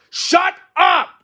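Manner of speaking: high, loud shout; scream. Acted. Toad cuts off the strange voice, high furious scream. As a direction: scream to silence the announcer. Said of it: "screamed Toad".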